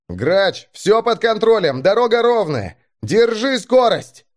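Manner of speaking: high, urgent call of support; encouraging shout. A narrator's reading. Vector supporting his friend at the wheel, shouting. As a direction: shout to keep his nerve up. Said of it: "пытаясь поддержать друга, крикнул".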